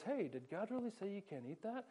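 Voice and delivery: soft, sneaky serpent question; sly Satan. Acted. Pastor voicing Satan to Eve in a skit, sly and soft. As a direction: coax her quietly like a tempter.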